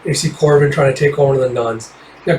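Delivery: normal talking voice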